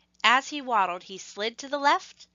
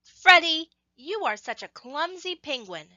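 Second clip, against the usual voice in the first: teasing penguins; 6 semitones higher, 3 dB louder than usual. Acted. Penguins tease clumsy Freddy.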